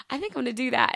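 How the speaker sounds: put-on playful amateur British accent. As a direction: have another clumsy go at a British accent. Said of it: "trying my best to talk with a British accent".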